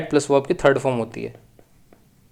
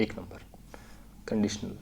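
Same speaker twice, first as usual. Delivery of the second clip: whisper; 6 semitones lower, 17 dB quieter than usual. Real, not acted. Aside naming the structure.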